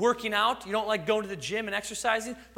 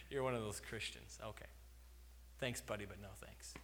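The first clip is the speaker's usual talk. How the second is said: lower, much softer brush-off of a stranger, lightly voiced; dismissive stranger; 8 semitones lower, 15 dB quieter than usual. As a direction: shrug them off like an annoyed passerby. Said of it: "they go".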